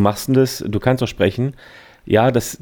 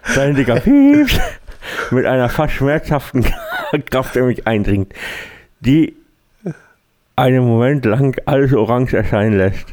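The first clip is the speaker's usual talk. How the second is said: muffled reading through a cork, stifling laughs; talking with a cork in the mouth; 3 semitones higher than usual. Shown as a demonstration. Friend keeps reading with a cork in mouth, muffled. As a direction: push the words out past a cork in your mouth. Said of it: "du sprichst dann so und dann liest du einen Text vor".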